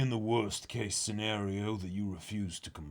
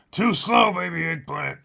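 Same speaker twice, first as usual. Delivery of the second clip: higher, louder taunting chef; mocking old chef; 9 semitones higher, 10 dB louder than usual. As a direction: needle the boy with glee.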